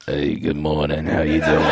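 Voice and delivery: very low, soft early-morning phone voice; Miss Pat. Retold or imitated. Older comic calls early in the morning, imitated low greeting. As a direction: imitate a deep, subdued caller's greeting. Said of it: "Miss Pat will call me".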